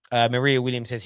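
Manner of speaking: normal talking voice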